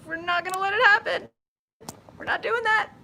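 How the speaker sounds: high, loud playful warning; mock stern at phone. Real, not acted. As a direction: tell the phone off, half laughing. Scolding her phone not to die.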